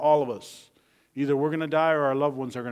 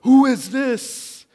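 loud, high, drawn-out astonished question; acted terrified awe; 10 semitones higher, 12 dB louder, much slower than usual. Terrified disciples asking each other about Jesus, awestruck. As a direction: ask it slowly, as if overwhelmed. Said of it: "They were terrified, and they asked each other".

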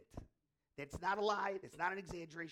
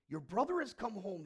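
higher, louder happy news; joyful announcement; 4 semitones higher, 8 dB louder than usual. Acted. Servant telling the older son his brother is home, joyful. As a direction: announce it with mild acted joy. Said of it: "he replied with joy".

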